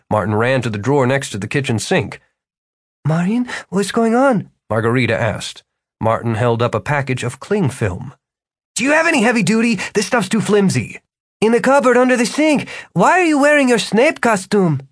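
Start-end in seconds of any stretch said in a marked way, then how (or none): 3.1-4.5 worried mother. Acted. Mother asks. 8.8-11.0 fast, high and hurried. Acted. Martin rushing. 11.4-14.8 high, yelling over noise; yelling over sirens. Acted. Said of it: "Margarita had to yell".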